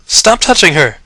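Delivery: loud high shout; ringing shout. Acted. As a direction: yell at the unseen attacker. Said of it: "his voice rang out".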